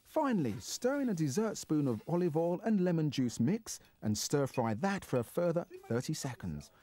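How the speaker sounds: measured, clearly enunciated recipe read; recipe voice-over. Acted. Presenter takes viewers through the dish, recipe voice-over. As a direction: read out the method step by step.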